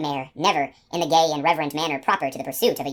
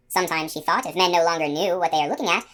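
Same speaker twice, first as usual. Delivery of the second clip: usual speaking voice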